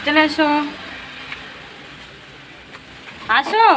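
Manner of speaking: real summoning call. Real, not acted. Calling someone to come along.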